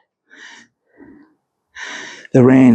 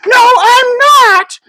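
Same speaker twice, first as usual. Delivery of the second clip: put-on much higher, louder shrieking old woman's cry; 6 dB louder, slower than usual. A narrator's reading. The cursed shrew answers John, shrieking in her new voice. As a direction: shriek it out in a panicked crone's voice. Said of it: "I shrieked in my new voice".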